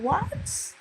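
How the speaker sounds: high incredulous what; shocked what. Retold or imitated. Student reacting to the insult, high shocked disbelief.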